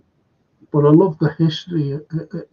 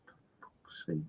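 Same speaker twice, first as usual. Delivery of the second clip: muttered under the breath, voiceless; whispered disgust; 7 semitones lower, 30 dB quieter than usual. Real, not acted. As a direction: breathe it out in disgust, barely audible. Disgusted aside about immigration.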